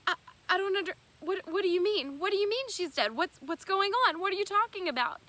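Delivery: high, loud, frantic confusion; shocked panic. Retold or imitated. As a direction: blurt it out in disbelief, fast at the end. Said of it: "I was in shock".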